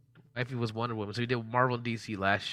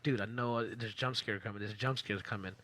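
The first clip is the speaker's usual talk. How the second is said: softer, muttered self-warning; retold dread; 3 semitones lower, 7 dB quieter than usual. Player to himself before a jump scare, hushed retold dread. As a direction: quietly retell bracing for a scare.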